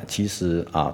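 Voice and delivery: ordinary talk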